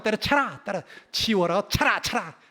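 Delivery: hot-tempered Gyeongsang dismissal; Gyeongsang dialect. Acted. Preacher mimicking locals saying give it all up, Gyeongsang dialect. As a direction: toss out Gyeongsang commands impatiently. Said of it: "막 성질이 급하니까".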